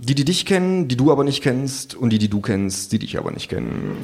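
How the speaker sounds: ordinary delivery, nothing marked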